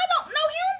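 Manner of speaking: very high, pleading squeak; panicked protest. Retold or imitated. To friends sending her off, very high frightened protest. Refusing to go to stranger's house.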